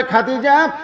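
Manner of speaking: high, insistent sermon question; dramatic preaching. Acted. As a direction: raise the pitch and press the question. Preacher voices Prophet's question. (উঁচু গলায় জোরালো প্রশ্ন)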